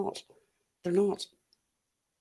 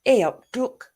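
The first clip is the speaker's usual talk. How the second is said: Nottingham greeting; 7 semitones higher, 8 dB louder than usual. Shown as a demonstration. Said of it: "I love your accent".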